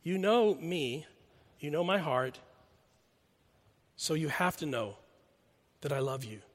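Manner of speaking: soft, humble admission; a humbled disciple answering his teacher, soft and sincere; humble confession. Retold or imitated. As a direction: quietly lay your heart open. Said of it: "Almost as if to say".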